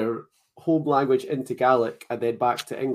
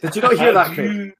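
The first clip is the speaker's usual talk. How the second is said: mock indignant; 8 semitones higher, 8 dB louder than usual. Mock scolding over shushing.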